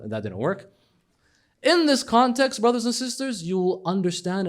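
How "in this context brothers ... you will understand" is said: preaching. Real, not acted. Preaching address.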